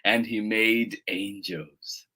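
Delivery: slow, low storytelling voice; gentle bedtime-story pace; picture-book narration. A narrator's reading. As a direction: read slowly to little children, letting phrase ends sink. Reads picture book aloud.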